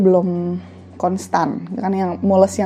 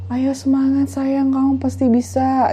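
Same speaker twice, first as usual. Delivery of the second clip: lightly acted cheering-on, pitched up; slow, higher coaxing; encouraging husband; 6 semitones higher, slower than usual. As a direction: imitate a husband urging his wife on. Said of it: "gitu kata suaminya".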